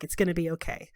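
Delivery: soft, quiet comfort; reassuring. Acted. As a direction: reassure gently.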